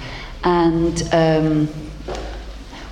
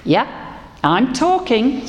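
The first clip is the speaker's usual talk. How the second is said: higher, slow and deliberate frog reply; frog's voice; 7 semitones higher than usual. A narrator's reading. Frog answers the peering woman, higher and slow. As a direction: drawl it out a little, pleased to be noticed. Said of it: "said the frog".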